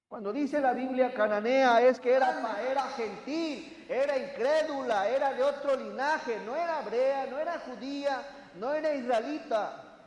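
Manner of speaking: loud, fervent sermon delivery; preaching at full force. Acted. Preacher rousing the congregation, loud and fervent.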